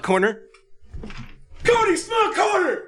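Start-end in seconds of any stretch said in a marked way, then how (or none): 1.6-2.8 very high-pitched announcing yell; shouted jingle-style announcement; sudden yell. Pretend. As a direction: yell it out like a segment title, far higher. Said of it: "Why did you just yell it?".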